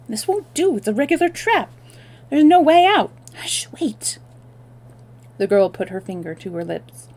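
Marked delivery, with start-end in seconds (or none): 0.1-3.1 high, urgent trapped voice; alarmed. Acted. 3.3-4.2 urgent unvoiced whisper. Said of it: "explained in a whisper".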